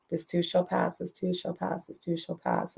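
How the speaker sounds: soft, hushed repeated reassurance; calm her down gently over and over; mother softly chanting comfort; soothing mother. Retold or imitated.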